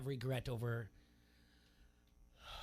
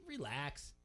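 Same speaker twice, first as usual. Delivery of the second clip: mock breezy reassurance; 10 semitones higher than usual. Telling a worried friend everything's fine, higher and mocking. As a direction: play the carefree patriot waving off worries. Retelling his old dismissive reassurance.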